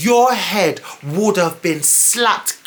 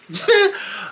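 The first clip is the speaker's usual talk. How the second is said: short laugh. Real, not acted. Laughing at herself.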